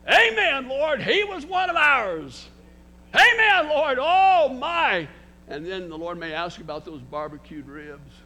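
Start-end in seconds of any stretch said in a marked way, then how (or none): 0.1-5.1 much higher and louder, slow, overjoyed exclaiming; joyful amen. Pretend. Pastor rejoicing before God, a loud, slow, joyful amen. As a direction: shout it to the Lord with delight.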